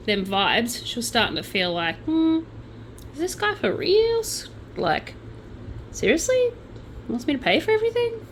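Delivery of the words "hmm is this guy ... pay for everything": high-pitched, incredulous questioning; sceptical. Retold or imitated. Woman doubting her rich boyfriend who wants her paying, sceptical. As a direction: voice the dawning doubt, pitched well up.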